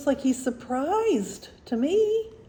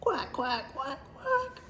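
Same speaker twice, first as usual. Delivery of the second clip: soft quacks leaping up high; little duck chatter, rising at the end; flock of ducks; 3 semitones higher, 6 dB quieter, slower than usual. A narrator's reading. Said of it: "Look at all the ducks".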